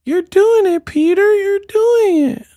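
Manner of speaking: squeaky high-pitched cheer. Retold or imitated. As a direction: squeak it like a cartoon fairy. Mock Peter Pan cheer.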